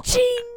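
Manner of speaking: high metallic ching; gun shell sound effect. Pretend. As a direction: mimic a shell ejecting. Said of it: "That's the shell getting ejected".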